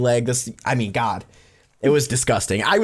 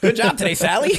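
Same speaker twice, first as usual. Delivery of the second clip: lightly voiced, higher-pitched casual sign-off; mock actor off duty; 8 semitones higher than usual. Actors greeting each other as the show ends, mock casual and high. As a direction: imitate a cheerful after-work goodbye, pitched up.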